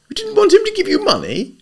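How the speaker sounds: high, loud incredulous echo; puzzled disbelief; wondering. A narrator's reading. Freddie echoing Eve, wondering disbelief. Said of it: "repeated Freddie wonderingly".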